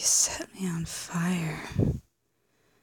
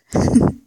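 bright laugh; 13 dB louder than usual. Acted. Laughs at the memory.

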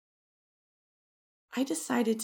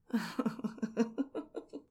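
long giggle rising in pitch; playful, climbing giggle; teasing laugh; 2 semitones higher than usual. Acted. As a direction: laugh it out in a rippling run. Laughs at the partner's want.

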